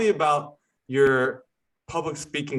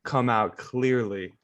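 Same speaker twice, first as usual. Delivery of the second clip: slowed down, every word placed with care; slow it right down and land each word; careful, unhurried podcast delivery; deliberately slow; slower than usual. Shown as a demonstration. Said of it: "I'm trying to be really intentional right now with slowing".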